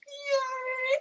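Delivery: high, drawn-out squeal of delight; high sing-out "yay"; celebratory cheer. Real, not acted. Celebrating her fifth live stream.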